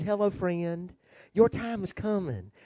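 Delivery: soft, smooth preacher impression; Joel Osteen. Telling the congregation how he preaches, soft preacher impression. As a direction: mimic a gently reassuring TV preacher. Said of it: "this is the way he preaches".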